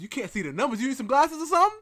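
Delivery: teacher mocking the student's excuse. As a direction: sneer it back at the student.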